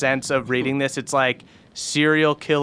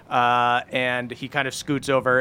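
ordinary delivery

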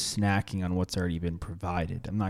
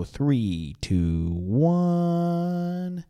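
drawn-out countdown; 8 semitones higher, 4 dB louder, much slower than usual. Acted. Countdown back into show.

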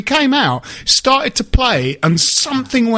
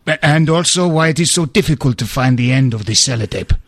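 calm mock Portuguese footballer accent; lightly put-on Portuguese accent, lower; Luis Boa Morte accent; 6 semitones lower than usual. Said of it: "by attempting the accent".

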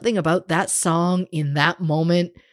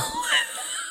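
squeaky laugh; 9 dB quieter than usual. Real, not acted. Laughing at herself unwell.

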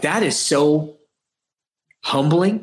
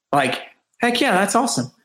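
mock excited, pitched up high; an imitated, pitched-up heck yeah; 6 semitones higher than usual. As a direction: retell the thrill of finding the beers. Retelling his excited reaction.

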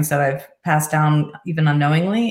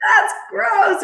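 much higher, teasing "ew"; playful mock disgust; 14 semitones higher than usual. Mother laughing along with her teenage son, playful mock disgust. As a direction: squeal it like a grossed-out parent.

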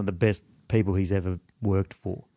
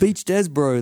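mock Kiwi stoner; 6 semitones higher, slower than usual.

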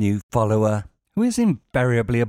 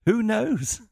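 put-on breathy girl voice; 11 semitones higher than usual. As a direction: read it like a dreamy bot profile. Coy aside in voice.

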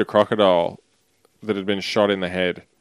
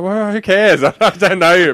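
laughing retort; 9 semitones higher, 5 dB louder than usual. Retold or imitated. Retold reaction to stranger grabbing leg.

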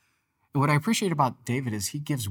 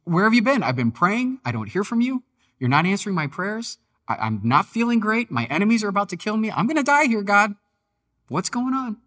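much higher, loud frantic pleading; desperate angry outcry; 10 semitones higher, 7 dB louder than usual. Retold or imitated. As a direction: shout at God as if about to die. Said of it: "He's like".

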